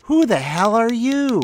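high, loud squeaky pixie demand; angry pixie squeak. Acted. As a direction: squeak out an angry fairy challenge. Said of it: "the angry pixie squeaked".

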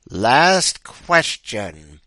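very slow, loud, drawn-out announcement; ominous quizmaster. Acted. Bow-tie man addressing the remaining three, ominous announcement. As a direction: stretch both words for menace.

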